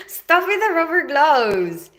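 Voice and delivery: laughing, slow, stop with the gloves; laughing protest. Real, not acted. Laughing at a viewer's joke about rubber gloves. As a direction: tease them to quit through a laugh.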